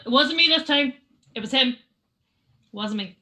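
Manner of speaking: high, very loud in-character denial, easing off at the end; loud protest of innocence. Acted. Zia with her hand up, loud defensive protest. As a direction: blurt it out defensively.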